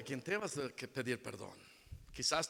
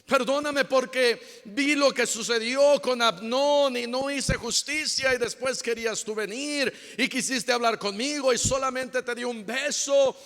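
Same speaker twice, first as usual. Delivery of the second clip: lament; 7 semitones higher, 7 dB louder than usual. Retold or imitated. David laments to Absalom.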